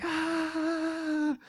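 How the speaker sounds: high, drawn-out imitation wail; cartoonish toddler crying; mock bawl. Three-year-old set down on the trail, mock bawling. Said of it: "starts bawling".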